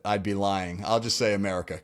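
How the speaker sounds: usual speaking voice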